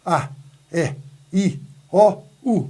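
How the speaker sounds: "A, E, I, O, U" one by one; vogais isoladas. Shown as a demonstration. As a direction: sound each of "A, E, I, O, U" separately, uninterrupted. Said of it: "assim, ó". ("A, E, I, O, U", cada vogal sozinha)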